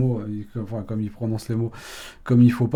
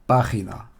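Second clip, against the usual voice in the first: página stressed on "pá"; antepenultimate stress. Shown as a demonstration. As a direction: model PÁ-gi-na, página, for learners. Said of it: "accentués sur l'antépénultième syllabe".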